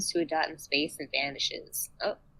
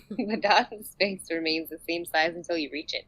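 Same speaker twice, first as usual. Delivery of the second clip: ordinary talk, nothing marked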